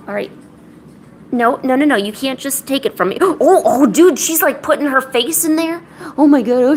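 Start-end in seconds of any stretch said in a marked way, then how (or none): 3.2-6.8 gasping, high and loud; startled. Real, not acted. Owner watches the cat dive into the treats, startled outburst. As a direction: react with a jolt, pitch and volume up.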